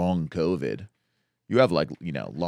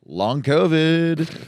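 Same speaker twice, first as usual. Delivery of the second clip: drawn out; 9 semitones higher, 4 dB louder, much slower than usual. Pretend. Hyping the joke name.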